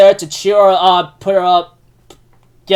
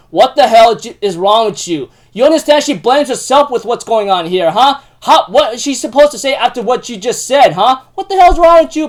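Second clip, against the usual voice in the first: loud, higher-pitched angry confrontation; Hatsuharu's total rage; 6 semitones higher, 5 dB louder than usual. Retold or imitated. Retelling Hatsuharu confronting Rin's parents, angry rant. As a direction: fire off indignant questions in retold fury. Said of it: "in total anger and rage".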